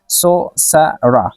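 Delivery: "सोसरा" slowly, in separate syllables; syllable-by-syllable pronunciation. Shown as a demonstration. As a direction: break "सोसरा" into सो, स, रा with short gaps. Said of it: "इसको हम pronounce करेंगे". ("सोसरा" को ठहर-ठहर कर बोलना)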